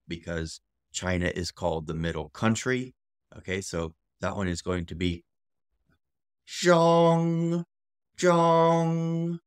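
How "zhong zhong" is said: ZHONG held high and level; long, steady high ZHONG; tone demonstration. Shown as a demonstration. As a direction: sustain ZHONG loudly on one high pitch.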